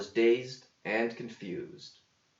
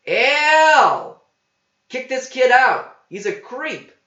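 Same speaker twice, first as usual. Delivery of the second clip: shrieking much higher and louder; yelling for Al; 12 dB louder, slower than usual. A narrator's reading. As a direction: scream for the cook to throw him out. Waitress yells for the cook.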